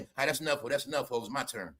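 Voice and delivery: soft low voice, imitating [name]; mock [name]. [name] on stage hushing [name] after the kiss, soft and low. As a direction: quietly claim the stage back. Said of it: "she did a kiss yesterday".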